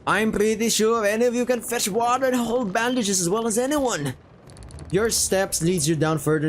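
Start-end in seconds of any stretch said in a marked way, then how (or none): 0.1-4.1 high-pitched character voice, held up throughout; insistent sailor voice. Acted. Sailor recruiting the newbie as helper, high insistent voice. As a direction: push the pitch up, dismissively insistent.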